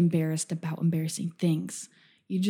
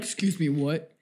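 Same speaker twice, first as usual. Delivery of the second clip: lightly lowered mock macho challenge; tough man voice; 3 semitones lower than usual. Host pretending to confront the hiker, mock tough-guy voice. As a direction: puff up like a guy squaring off. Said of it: "I should have took the man voice out".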